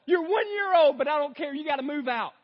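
loud, high ranting yell; frantic shout. Retold or imitated. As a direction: shout it out, totally losing it. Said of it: "And then I go".

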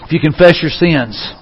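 low, hushed invitation to prayer; quiet, reverent close of a sermon; soft altar call. Real, not acted. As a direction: gently lead them to bow their heads, leaving long pauses. Leads closing altar call.

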